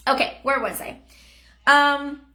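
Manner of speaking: ordinary talk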